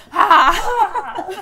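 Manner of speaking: loud, high "Ah!"; mock startled shout. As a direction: imitate a startled yelp at full volume. Acting out someone's startled surprise pose.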